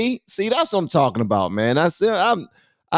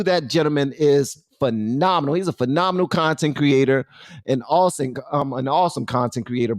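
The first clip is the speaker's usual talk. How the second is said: ordinary talk, nothing marked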